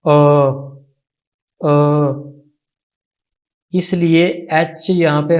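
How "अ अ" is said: अ said as a short open vowel sound; vowel sound demo. Shown as a demonstration. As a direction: model the vowel अ cleanly. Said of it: "उसकी pronunciation, उसका sound था". (अ की साफ़ आवाज़)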